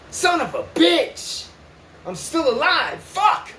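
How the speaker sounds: slow, high groan of disbelief at still being alive; bitter disbelief. Retold or imitated. Waking up alive after hanging, bitterly stunned. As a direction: drag out the curse, stunned and bitter.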